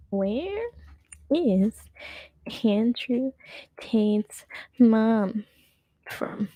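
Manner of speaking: halting, word by word with long pauses; typing aloud. Real, not acted. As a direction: say each word slowly as you type it. Typing a search question aloud.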